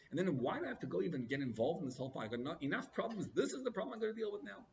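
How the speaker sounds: usual speaking voice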